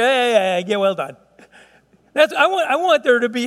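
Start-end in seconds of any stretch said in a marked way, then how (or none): 0.0-1.2 "well done" tossed off without interest; half-hearted praise. Pretend. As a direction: shrug out a lukewarm well done. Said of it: "not just hear".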